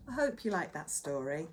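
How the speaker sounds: plain reading voice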